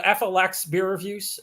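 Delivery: ordinary talk, nothing marked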